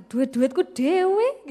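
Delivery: slow, drawn-out self-justifying; smug excuse. Acted. Preacher mimicking her past excuse for shopping. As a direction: stretch it out, pleased with yourself.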